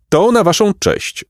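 lightly voiced bird, high pitch; high, courtly pelican; pompous pelican. A narrator's reading. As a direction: offer a grand tribute. Story figure, pelican.

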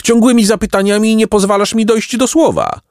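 very high-pitched exasperation; protest, fed up with being interrupted; impatient. A narrator's reading. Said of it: "rzekł zniecierpliwiony Jacek".

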